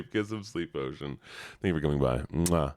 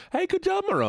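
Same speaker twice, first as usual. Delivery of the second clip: high praise. Real, not acted.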